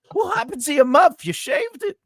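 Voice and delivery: high-pitched shocked voice; high mock shock. Quoting the show's scene.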